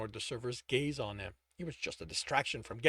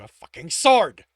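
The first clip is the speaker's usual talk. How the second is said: frustrated; 3 semitones higher, 15 dB louder than usual. A narrator's reading. Narration voicing his frustration.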